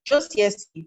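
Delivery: ordinary talk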